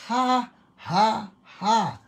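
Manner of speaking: high, loud, spaced-out ha's; deliberate forced laugh. Shown as a demonstration. Preacher showing laughter to people in pain, high forced laugh. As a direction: laugh by faith without feeling it. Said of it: "maski hindi ninyo feel".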